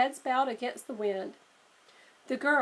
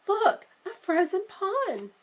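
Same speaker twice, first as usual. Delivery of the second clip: high, slower, pointing it out; delighted discovery; 9 semitones higher, 5 dB louder, slower than usual. Acted. Sister spotting a frozen pond, delighted. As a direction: show him the treasure.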